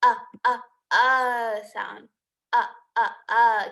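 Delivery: short "uh" sound repeated, clipped and separate; letter sound uh. Shown as a demonstration. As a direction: say "uh" as in umbrella, short and open. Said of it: "U makes the uh, uh, uh sound".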